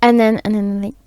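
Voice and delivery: ordinary delivery, nothing marked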